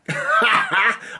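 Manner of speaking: high, bright laugh that falls away; real burst of laughter. Real, not acted. Laughing at his own ridiculous handling of it. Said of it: "I'm still laughing".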